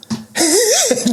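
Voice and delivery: real amused laugh; real high laugh. Real, not acted. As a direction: laugh out at your own boldness. Laugh after corporate confession.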